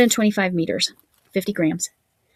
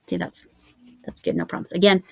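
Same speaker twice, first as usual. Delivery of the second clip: usual speaking voice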